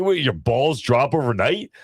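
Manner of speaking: high, drawn-out mock disbelief; incredulous question. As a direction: question your kid as if you can't believe it. Retold disbelief at son's voice.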